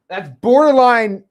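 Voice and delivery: drawn-out high, loud exclaim; gushing over a buddy's big deal; amazed. Real, not acted. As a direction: stretch it out in awe.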